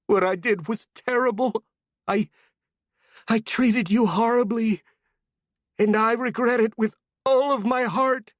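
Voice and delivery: high, tearful apology; tearful plea. Acted. An old woman in tears begging the girl's forgiveness. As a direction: beg forgiveness near tears. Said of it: "eyes full of tears".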